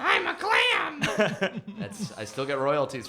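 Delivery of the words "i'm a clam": high, very slow laughing clam voice. Shown as a demonstration. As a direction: squeal it like a teenage clam. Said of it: "the voice of a teenage clam named Clamantha".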